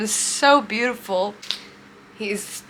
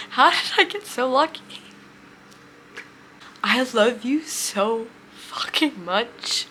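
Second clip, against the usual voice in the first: overcome with love. Real, not acted. Telling partner "I love you", overcome and tearful. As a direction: voice thinning, tearful and halting.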